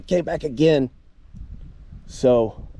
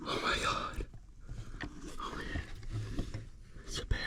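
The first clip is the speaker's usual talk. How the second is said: whispering; 15 dB quieter than usual. Real, not acted. Night, bear found near camp.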